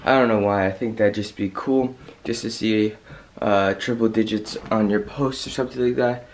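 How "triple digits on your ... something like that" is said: usual speaking voice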